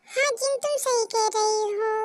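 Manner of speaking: ordinary delivery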